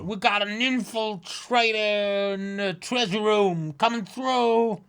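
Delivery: high cartoon guard raising alarm; squeaky guard alarm. Guard shouting to the whole castle. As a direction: call it out like a panicked little guard.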